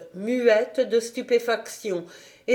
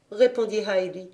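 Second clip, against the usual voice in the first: plain reading voice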